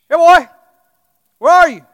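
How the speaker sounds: loud, high call across the pasture. Retold or imitated. Farmer calling his lost dog, loud call across the fields. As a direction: shout for the dog far away.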